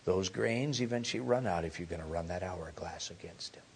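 preacher whispering a warning to the congregation; whispered warning. Real, not acted. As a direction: whisper it low.

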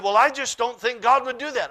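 loud, high, indignant objection; insistent protest. Retold or imitated. Woman arguing with the preacher, insistent protest. Acted protest in retold argument.

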